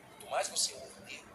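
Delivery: soft whispered repeat; whispered echo. Acted. Pastor echoing his point to congregation, whispered. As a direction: drop it to a whisper.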